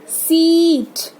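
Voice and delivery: सीट with a very stretched ee; long i. Shown as a demonstration. Teacher models the big i, सीट drawn out. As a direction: hold the vowel of सीट slowly. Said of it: "बड़ी ई का sound और ये longer".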